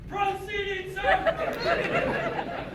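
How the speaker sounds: a faraway parade-ground cry, held back; replayed holler. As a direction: squeal the shout softly, high and drawn out.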